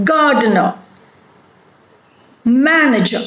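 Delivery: each word from "happily" to "character" stressed on its first syllable; strong start then falling away, "happily" through "character". Shown as a demonstration. Teacher asking where stress falls, "happily" to "character" front-stressed. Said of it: "It is on the first syllable".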